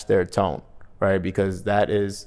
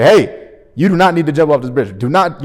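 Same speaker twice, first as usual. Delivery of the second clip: loud, higher desperate call; urgent plea; 5 semitones higher, 7 dB louder than usual. Retold or imitated. Stranger on a bridge begging someone not to jump, loud and urgent. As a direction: shout to stop them jumping. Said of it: "do whatever you can".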